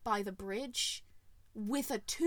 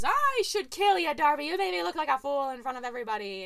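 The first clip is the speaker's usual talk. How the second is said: angry king; 2 semitones higher, 5 dB louder than usual. Retold or imitated. King confronts Darby angrily.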